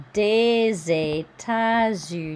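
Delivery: Des États-Unis slow, des linked with a Z sound; careful French liaison in Des États-Unis. Shown as a demonstration. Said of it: "here we have liaison".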